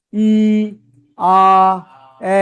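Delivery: ই, আ, এ each held long; long vowels. Shown as a demonstration. Teacher showing learners how long vowels sound, drawn-out long vowels. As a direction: stretch out ই, আ, এ one at a time. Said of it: "সাউন্ডটা একটু long হবে".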